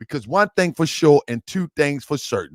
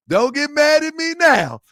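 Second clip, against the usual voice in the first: usual speaking voice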